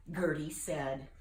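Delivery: usual speaking voice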